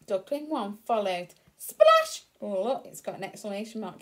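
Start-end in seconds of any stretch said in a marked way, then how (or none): 1.6-2.2 very high, loud Splash; shouted splash. Shown as a demonstration. Teacher showing the child how to read Splash, loud high shout. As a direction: shout Splash with the exclamation mark. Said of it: "so we have to shout".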